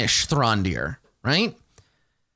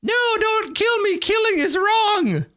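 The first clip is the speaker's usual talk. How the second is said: kobold voice. Acted. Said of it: "Instead of simply being like".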